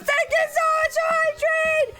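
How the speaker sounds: high-pitched, delighted thanks; gushing thanks. Real, not acted. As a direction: gush thanks in a squeaky high voice.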